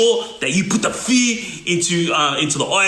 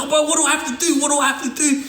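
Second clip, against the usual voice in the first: desperate plea; 9 semitones higher, faster than usual. Retold or imitated.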